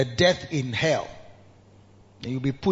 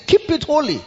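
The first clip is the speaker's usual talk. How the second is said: higher, louder proclamation; emphatic command; 10 semitones higher, 5 dB louder than usual. Acted. Preaching emphasis.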